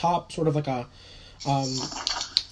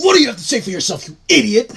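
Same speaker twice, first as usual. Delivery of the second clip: loud, fast, accusing cop; yelling police officer; 4 semitones higher, 7 dB louder, faster than usual. Acted. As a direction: demand an answer at full volume, in character. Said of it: "Just yelling".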